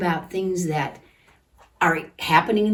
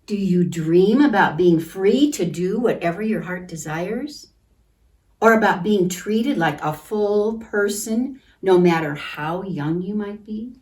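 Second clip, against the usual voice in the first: storybook reading; 5 dB louder than usual. A narrator's reading. Teacher reading a picture book to children, gentle.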